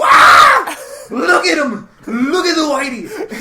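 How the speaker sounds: high, loud laughing shout; crowd shouting and laughing. Retold or imitated. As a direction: yell it gleefully at the goofy comic. Crowd reacting to comic.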